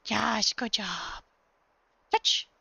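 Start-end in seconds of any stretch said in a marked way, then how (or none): 0.1-1.2 slow, low, soothing praise; calm the dog with a slow low yes. Real, not acted. To her dog after a trick, slow soothing praise.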